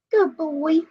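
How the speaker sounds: high, bright-eyed praise for the dog; pet praise voice. Real, not acted.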